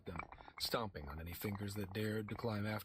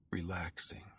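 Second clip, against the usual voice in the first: breathy whispered relaxing; 4 semitones lower than usual. Shown as a demonstration. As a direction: whisper it to yourself to calm down. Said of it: "Relaxing (first take voiced)".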